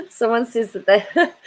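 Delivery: real laughter breaking into the words; cracking up. Real, not acted. Reading out a chat nickname, cracking up. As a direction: start laughing mid-sentence, ending on a rise.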